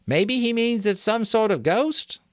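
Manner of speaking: put-on high, tentative boy's voice; boy speaking out of turn. A narrator's reading. A boy in the dark suggesting it, high and tentative. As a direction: venture a guess.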